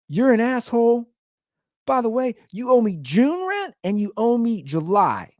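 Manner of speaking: worked-up retelling of a telling-off; retold angry rant. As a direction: lay into the roommate. Retells his drunken rant about rent.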